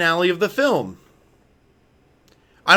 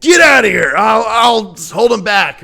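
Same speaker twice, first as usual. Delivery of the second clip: higher, louder, slowed heroic call; mock heroic shout; 4 semitones higher, 6 dB louder than usual. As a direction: play the noble sacrifice, overdone.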